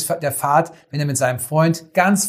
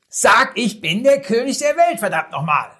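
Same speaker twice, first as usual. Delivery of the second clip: impatient shout; 7 semitones higher than usual. Retold or imitated. Director loses patience, third call.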